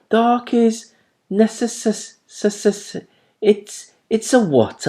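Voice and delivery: high, slow, stuttering on "necess-"; stumbling over the word. A narrator's reading. Owlet struggling to say the long word, stammering. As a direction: trip on "necessary" as s-, s-.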